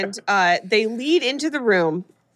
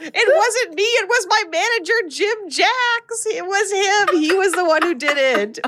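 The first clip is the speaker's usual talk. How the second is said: high-pitched panicked pleading; frantic protest; 10 semitones higher than usual. Retold or imitated. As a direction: mock-desperate blame-shifting, pitched way up. Frantic blame-shifting to a demon, acted.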